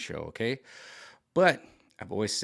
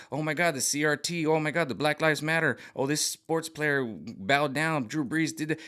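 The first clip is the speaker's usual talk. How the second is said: higher, mock-panicked media voice; mock hysteria; 6 semitones higher than usual. Mocking media outrage over sports players, a higher hysterical voice. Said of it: "now you can start to see the levers".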